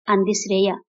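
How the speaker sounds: plain reading voice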